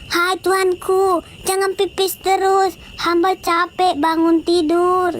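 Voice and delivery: high, slow weary complaint; a worn-out mother pleading at night; tired mother. Acted. Mary begs baby Jesus to stop peeing, high and slow. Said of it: "kata Bunda Maria".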